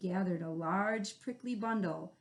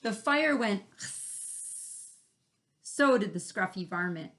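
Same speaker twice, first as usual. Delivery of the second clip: usual speaking voice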